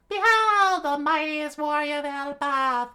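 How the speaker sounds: very high, loud heroic proclamation; screamed, booming. A narrator's reading. Giant proclaims himself to Usopp and Nami, booming scream. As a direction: bellow it out in falsetto, stretching words. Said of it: "he screamed out, uh, with a booming sound".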